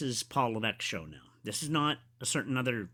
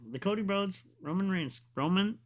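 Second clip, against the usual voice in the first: usual speaking voice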